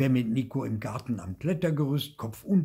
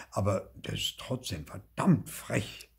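ordinary delivery

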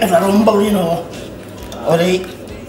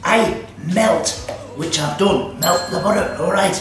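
broad hammed-up Scottish accent all through; comic Scottish accent. Cooking show host chatting to viewers, in a broad comic Scottish accent. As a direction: play a cheeky Scottish baking-show host.

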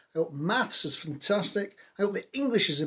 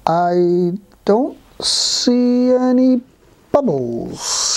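very drawn-out words with pauses; ponderous storybook creature at half speed; slow, doubting snail; 5 semitones higher, 8 dB louder, much slower than usual. Acted. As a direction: stretch it out like a sceptical snail. Snail poses awkward, doubting question.

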